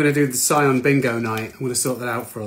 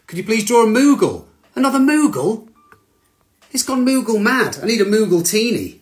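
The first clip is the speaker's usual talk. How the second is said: excited; 8 semitones higher, 6 dB louder than usual. Real, not acted. Excited by Moogle requests.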